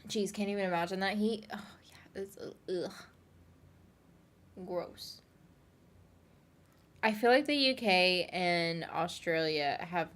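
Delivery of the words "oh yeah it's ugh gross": disgusted whisper. Real, not acted. Disgusted at the heat.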